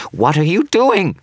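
panicked shriek, very high and loud; high-pitched shriek. Acted. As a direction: shriek it in pure horror. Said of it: "his voice rising to a high-pitched shriek".

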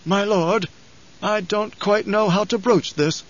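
put-on an old aide reluctant to speak up; hesitant old advisor. A narrator's reading. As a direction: pitch it up and pick the words slowly. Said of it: "maintained their wrinkled stiffness as he said".

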